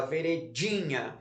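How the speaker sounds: "veredinha" with a strong DI, ve-re-DI-nha, falling; stress on DI. Shown as a demonstration. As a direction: confirm "veredinha" by leaning on DI. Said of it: "é a penúltima sílaba". ("veredinha" com a penúltima sílaba forte, DI)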